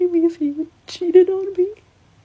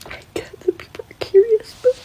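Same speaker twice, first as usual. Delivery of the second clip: crying; 5 semitones higher than usual. Real, not acted. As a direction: speak through real tears. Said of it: "this crying woman".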